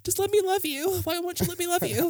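very high mock-pleading falsetto; lovesick teen. Lovesick teen begging the idol for love, hammed-up high pleading. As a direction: beg like a hopelessly smitten teenager.

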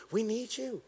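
ordinary talk

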